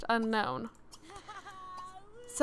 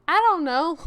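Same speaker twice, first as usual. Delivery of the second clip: loud, high mock cluelessness; mocking evasive answer; 5 semitones higher, 11 dB louder than usual. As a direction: imitate her with an exaggerated, high shrug of a voice. Mocks book heroine's evasive answer.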